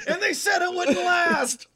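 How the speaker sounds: mock proclamation. Joking about eight-day marriage.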